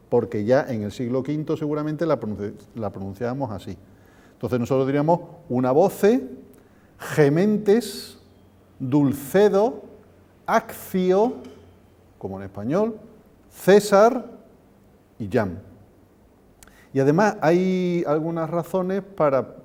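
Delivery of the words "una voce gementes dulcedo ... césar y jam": Castilian "gementes" with a J sound and "César" with a TH sound; Castilian Latin; Spanish-style Latin. Shown as a demonstration. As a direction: read the list the Spanish way, with emphasis. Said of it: "pronunciar estas palabras a la española".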